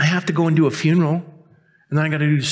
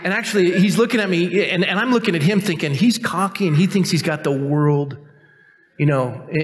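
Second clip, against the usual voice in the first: usual speaking voice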